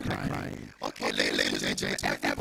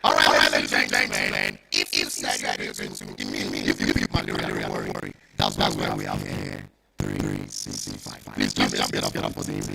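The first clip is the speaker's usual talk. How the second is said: hype MC; 9 dB louder than usual. Acted. MC hyping the crowd.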